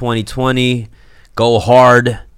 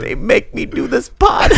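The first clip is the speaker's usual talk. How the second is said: mock complaint; 10 semitones higher than usual. Mock victim complaint, laughing.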